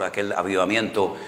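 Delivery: normal talking voice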